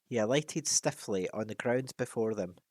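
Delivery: ordinary delivery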